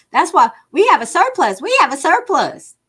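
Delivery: high, loud mocking voice; mocking her. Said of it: "That's what she's saying".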